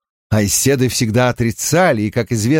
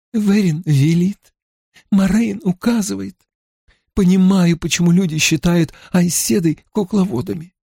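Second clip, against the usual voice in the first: put-on young woman voice; 5 semitones higher than usual. A narrator's reading. Voices Elayne in dialogue.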